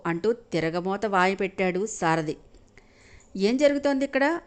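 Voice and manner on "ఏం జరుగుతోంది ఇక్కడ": higher, commanding question; grave demand. A narrator's reading. Jai on the steps demanding to know, grave and commanding. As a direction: demand an answer, stern and weighty. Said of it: "గంభీరంగా అడిగాడు".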